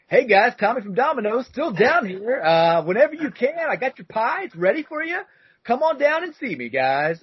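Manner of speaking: high, bright and eager, way up in pitch; Domino's guy impression. Delivery guy on the answering machine, high and upbeat. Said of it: "I'll do my best here to recreate".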